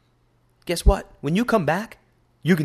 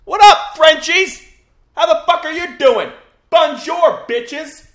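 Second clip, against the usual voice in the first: much higher and louder, greeting yell; hyped shout-out; 9 dB louder, slower than usual. Acted. Shouting a greeting to French listeners, hyped. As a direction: holler it like a hype man. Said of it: "I'm gonna do my shout out to France now".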